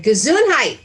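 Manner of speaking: "Gesundheit" called out loud and bright, falling at the end; husband's hearty blessing. Retold or imitated. Husband walks in blessing a sneeze, loudly. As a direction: boom the word "Gesundheit" like a greeting. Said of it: "He came in and he said".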